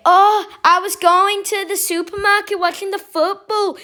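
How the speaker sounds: put-on high, quick Scouse accent; playful Scouse impression of family members; Liverpool accent. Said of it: "I'm gonna try and do an impression real quick".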